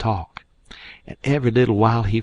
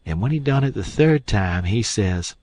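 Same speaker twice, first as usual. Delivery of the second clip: usual speaking voice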